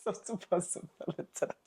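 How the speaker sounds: softly muttered, almost voiceless; muttered aside. Retold or imitated. She quietly repeats the neighbor's rule to her friend, muttered. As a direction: mutter it to yourself, half under breath.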